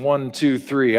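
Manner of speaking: ordinary talk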